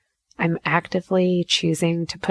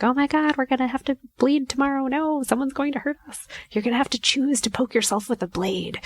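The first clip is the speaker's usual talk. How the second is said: high-pitched panicked inner voice; freaking out; 7 semitones higher than usual. Acted. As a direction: act out a brain in mock panic. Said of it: "freaking the fuck out".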